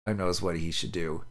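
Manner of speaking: usual speaking voice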